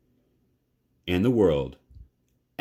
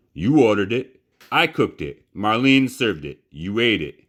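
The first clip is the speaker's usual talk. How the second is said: loud, hammering each short sentence; stern lecture; 4 semitones higher, 6 dB louder than usual. Acted. Neil lectures Bryce loudly, sentence by sentence. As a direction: lay out the charge, beat by beat. Cook lays out the charge.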